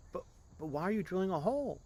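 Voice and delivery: high, fast stammering alarm. Acted. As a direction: stumble over the words in panic. Passengers alarmed by the drilling.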